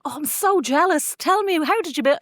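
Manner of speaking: high, put-on envious gush; mock jealous gush. Host gushing high over guest's home bar. Retells own jealous reply.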